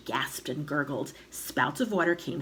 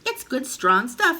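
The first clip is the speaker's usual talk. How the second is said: higher, louder and slow; emphatic reassurance; 7 semitones higher, 7 dB louder than usual. A narrator's reading. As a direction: sell it with relish. George cheerfully reassures shrieking grandma.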